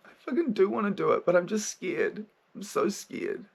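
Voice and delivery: high, cracking frightened confession; scared child voice. Acted. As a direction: admit fear like a small kid. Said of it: "the vulnerable child".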